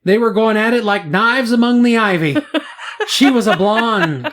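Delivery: amateur Scottish brogue for a news quote; Scottish accent. Retold or imitated. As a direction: read the quote in a mock Scottish voice. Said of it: "that was Scottish, not English".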